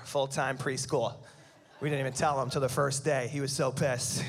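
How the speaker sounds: ordinary talk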